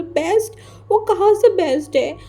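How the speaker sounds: incredulous scoff. Acted. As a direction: throw the word back at her, outraged. Wing scoffs at mother's praise. (अविश्वास से चिढ़ता सवाल)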